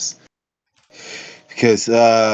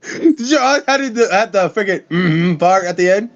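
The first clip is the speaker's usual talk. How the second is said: pitched way up in disbelief; squeaky high voice; 7 semitones higher than usual. Real, not acted.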